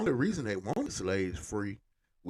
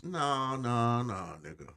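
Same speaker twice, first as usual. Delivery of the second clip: ordinary delivery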